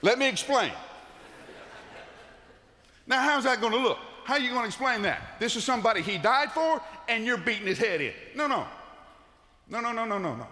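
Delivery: high, loud, incredulous preaching; preaching at full force. Acted. Preacher acts a shocked rebuke.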